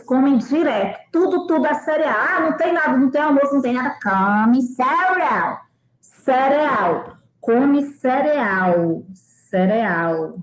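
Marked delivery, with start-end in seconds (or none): 4.0-7.2 "Come cereal" very slow and deliberate; slow model. Shown as a demonstration. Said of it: "professora modela devagar". 7.4-10.3 "Come cereal" very slow and deliberate; slow model. Shown as a demonstration. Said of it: "repete devagar".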